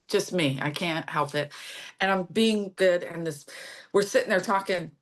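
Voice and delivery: usual speaking voice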